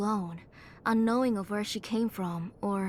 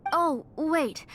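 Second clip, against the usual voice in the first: quick higher, louder "oh, wait"; sudden recall; 6 semitones higher, 4 dB louder than usual. Acted. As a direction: stop him before he leaves, a little startled. Suddenly remembers, stops him leaving.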